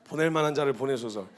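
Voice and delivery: low, crying, half-shouted plea; whining plea. Retold or imitated. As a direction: beg like a stubborn child close to tears. Moses pleading, stubborn refusal.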